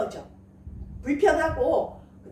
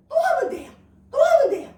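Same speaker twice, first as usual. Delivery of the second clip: much higher, lifted and cheering; bright encouragement; 11 semitones higher, 5 dB louder than usual. Acted. Pastor cheering the congregation on, high and excited. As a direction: cheer them on to try again.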